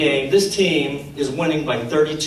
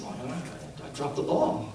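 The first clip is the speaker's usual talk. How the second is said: sheepish reply; 3 semitones lower, 11 dB quieter than usual. Retold or imitated. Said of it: "And he said".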